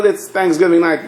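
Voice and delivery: ordinary talk, nothing marked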